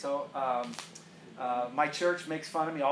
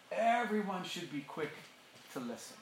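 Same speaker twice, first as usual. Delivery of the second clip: soft repeat; 7 dB quieter than usual. Shown as a demonstration. Repeats verse softly after loud.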